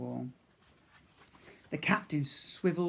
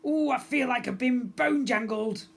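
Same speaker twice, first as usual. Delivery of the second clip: skeleton captain; 9 semitones higher, 6 dB louder than usual. Acted.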